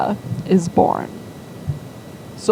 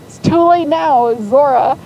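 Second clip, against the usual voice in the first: exasperated exclamation; 7 semitones higher, 6 dB louder than usual. Retold or imitated. Exclaiming that the name is fixed.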